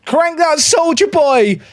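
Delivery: loud, slow celebratory shout; roaring celebration after scoring; goal celebration. Real, not acted.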